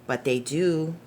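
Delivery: gentle storybook narration, unhurried; reading to children. Acted. Reading The Paper Boy aloud to young listeners, gentle storytelling. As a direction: read it like a bedtime picture book. Reads picture book aloud.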